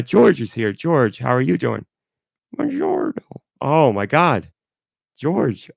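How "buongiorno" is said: very high squeaky put-on voice. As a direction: greet them like a tiny high-voiced Italian pal. Host voices [name] at table.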